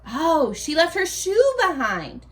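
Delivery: ordinary talk, nothing marked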